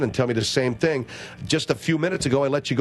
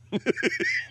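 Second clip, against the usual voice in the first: laugh; 4 dB quieter than usual. Real, not acted. Host laughs at own joke.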